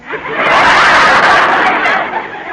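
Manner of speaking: rising breathy giggle; bright burst of giggles; giggling laugh. Acted. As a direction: laugh it off delightedly. Wife laughs at friend's remark.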